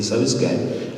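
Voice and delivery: ordinary talk, nothing marked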